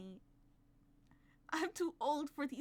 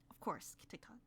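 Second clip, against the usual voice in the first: whispered aside; 5 semitones lower, 8 dB quieter than usual. Real, not acted. Aside while listening.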